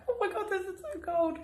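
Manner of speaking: high, soft gasp of mock shock; playfully imitated cold-shock squeal. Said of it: "at the time is like".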